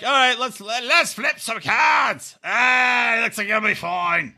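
high, hammed-up Cockney accent, slow on the card flip; psychic's Cockney voice. Mocking the psychic reading cards in a hammed-up Cockney accent. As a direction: ham up a Cockney card reader. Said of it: "Just like".

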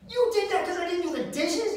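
high, loud mock disbelief; incredulous outburst. Comic retelling a fight over dishes, incredulous. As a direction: fire it back, comically appalled.

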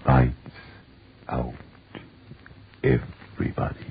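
hushed, breathless ghostly whisper; eerie whisper. Acted. Show's signature eerie opening.

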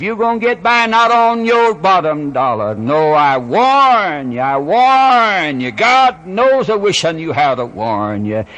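loud, high drawn-out pulpit cries; urgent preaching warning. Real, not acted. Preacher warning the hardened sinner, full-force preaching.

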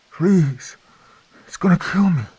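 high strained begging; strained plea. Acted. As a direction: beg in terror, straining. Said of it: "I felt the strain in her words".

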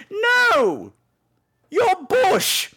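high, pointed genteel lady; prim British lady. Retold or imitated. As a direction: stress YOUR archly. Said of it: "the conversations are always like".

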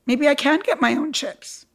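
ordinary talk

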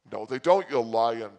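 a mock indignant shout at the casino man; loud retort. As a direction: call him a liar loudly. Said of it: "I'm like".

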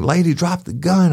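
high, urgent police command; shouted command. Retold or imitated. Cop retelling yelling at the woman to drop her gun. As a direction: bark it at the armed woman. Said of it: "I'm like".

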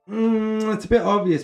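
high, loud mock-bored verdict; unimpressed quote. Recalling Ben Kingsley casting, high and dismissive. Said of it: "I was like".

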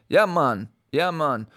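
imitated Jamaican accent, emphatic; casual put-on Jamaican accent. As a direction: demonstrate the Jamaican accent on a catchphrase. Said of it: "if I'm hitting it exactly".

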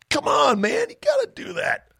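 very high, squeaky falsetto plea; mock pleading. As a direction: beg in a put-on falsetto cry. Mock pleading against his giving up.

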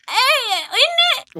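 put-on muddled Irish-Cockney accent, lively. Improviser joins the mall chat as an Irish character, broad shaky accent. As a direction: do a broad, dubious Irish accent, ending high and drawn out. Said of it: "what was that? What did you just do?".

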